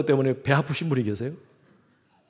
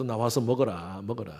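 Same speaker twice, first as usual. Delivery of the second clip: coaxing mother; 6 semitones lower, 6 dB quieter than usual. Retold or imitated. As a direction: gently coax the child out to eat. Mother coaxing sulking child.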